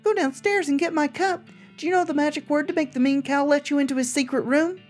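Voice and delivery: sweet and unsettling talking doll; too calm creepy doll. Acted. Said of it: "its mouth begins to move".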